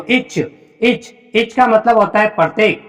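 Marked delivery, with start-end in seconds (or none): 0.0-0.4 इच said softly, short i and ch; teacher models इच clearly for students; model pronunciation of each. Shown as a demonstration. Teacher to students, model pronunciation of इच. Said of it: "तो यहाँ पे इच पढ़ेंगे इसको".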